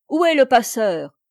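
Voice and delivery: loud demand; loud shout of a soldier; shouted question. A narrator's reading. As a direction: call it out hard into the cabin. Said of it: "cria-t-il".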